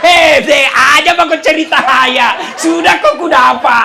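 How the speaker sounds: loud, laughing mock yell; hammed-up angry outburst; playful shouted scolding; mock shouting. Acted shouted quarrel.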